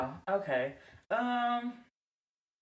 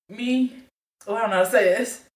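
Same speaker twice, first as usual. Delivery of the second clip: put-on Nigerian accent; 6 semitones lower than usual. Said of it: "I hear your African accent".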